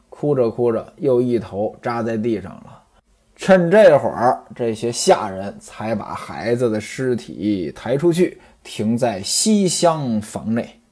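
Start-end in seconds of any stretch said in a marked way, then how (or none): none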